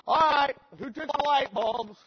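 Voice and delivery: high, loud call to a whole group; acted stern demand. Youth director confronting boys about stolen light bulbs, loud demand. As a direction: call out for the culprits.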